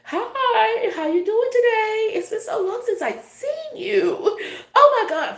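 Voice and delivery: very high, slow, drawn-out gushing greeting; fake sweet put-on voice. Imitating someone hiding real intentions, fake high-pitched gushing. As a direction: squeal a phony long-time-no-see hello. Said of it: "talk to me in your regular voice".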